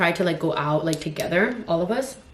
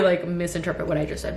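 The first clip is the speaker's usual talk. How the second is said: ordinary talk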